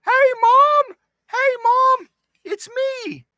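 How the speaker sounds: put-on very high, loud kid calling his mom. Little Scotty calling his mom from far away, high and loud. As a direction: shout up to Mom like a little boy. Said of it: "I feel like I wanna go".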